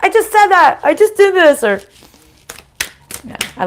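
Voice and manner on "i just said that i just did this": much higher and louder, amazed at the coincidence; surprised realization. Retold or imitated. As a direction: exclaim it like you can't believe it happened. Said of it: "you're like".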